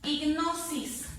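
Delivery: drawn-out "Hipnosis", force on NO; stressed syllable demo. Shown as a demonstration. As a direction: lean on NO in "Hipnosis". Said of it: "identifico la fuerza de voz".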